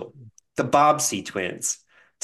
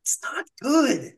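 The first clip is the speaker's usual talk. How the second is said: whispered, higher, slow; 10 semitones higher, slower than usual. Retold or imitated. Host whispering an aside about the book picks. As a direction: lean in and breathe it like a secret.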